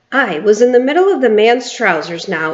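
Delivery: plain reading voice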